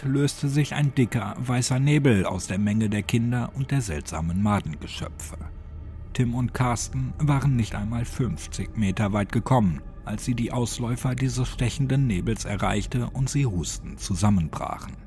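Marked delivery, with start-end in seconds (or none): none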